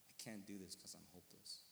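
low, soft, defeated confession; quiet hopelessness. Retold or imitated. Man breaking down before his pastor, quiet hopelessness. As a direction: say it small and sunken, retelling despair.

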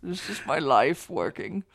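lightly voiced old lady; a hint of a Hepburn granny; Katharine Hepburn old lady. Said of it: "You do a good old lady voice".

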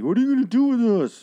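high, loud whiny complaint; mock the restless demanding crowd. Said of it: "they're just like".

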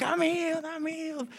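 high-pitched, fading joyful cry; overjoyed healed man. Retold or imitated. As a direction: mimic a man leaping with joy. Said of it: "jumping and dancing around".

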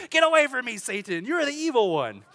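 high, loud comic banishing cry; hammed-up yell of rejection; mock rebuke. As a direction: shout it as if casting out the devil. Mock rebuke of telemarketer as Satan.

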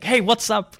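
imitating a shout across a short distance; retold shout. Retelling how they confronted a stalker, mild retold yell. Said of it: "screaming at him".

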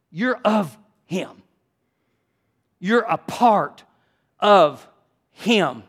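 "You're a part of him" slow, with pauses before "of" and "him"; emphatic accusation. Acted. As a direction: press each word of "a part of him" separately. Driving home the accusation word by word.

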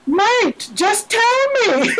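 a laughing exasperated just tell me; exasperated plea. Retold or imitated. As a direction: beg him to just say it. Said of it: "And I'm like".

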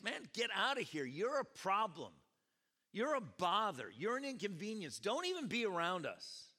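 lightly acted angry heckling; mock yelling. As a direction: scold him like an impatient mob. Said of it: "many of them yelling at him".

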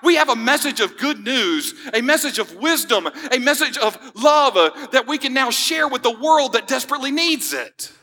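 high, ringing pulpit delivery over music; impassioned sermon climax, pitched high; full preaching voice. Acted. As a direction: preach it out with fervor. Preacher building to close.

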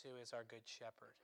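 ordinary delivery

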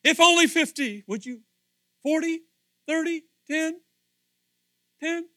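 high, loud pleading countdown; Abraham haggling with God over the city; bargaining plea. Acted.

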